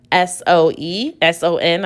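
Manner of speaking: ordinary delivery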